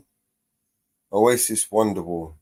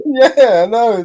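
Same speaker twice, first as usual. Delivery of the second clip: laughing yes, higher and louder; laughing yeah; 12 semitones higher, 8 dB louder, slower than usual. Real, not acted. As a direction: agree through a big laugh. Laughing agreement with friend.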